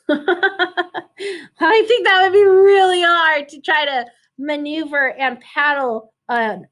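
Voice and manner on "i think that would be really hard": talking through a laugh, louder. Real, not acted. Teacher amused by the crowded canoe, laughing. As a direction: giggle the words out.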